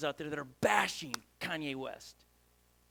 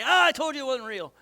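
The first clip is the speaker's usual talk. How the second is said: loud, higher sneering told-you-so; mocking scoff; 6 semitones higher, 8 dB louder than usual. Critics mocking a fallen believer, loud sneering scoff. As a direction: scoff at him like a smug critic. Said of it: "a bunch of people jump on and say".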